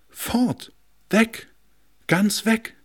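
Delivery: high, alarmed cry of loss; dismayed. A narrator's reading. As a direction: gasp it out, high and shocked. Furniture dismayed, flowers vanish.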